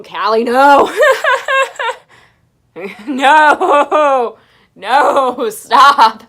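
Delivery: loud laughing no, stop; laughing yell at cat. Real, not acted. Yelling at her cat Callie to stop, laughing. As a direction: shout her off, cracking up.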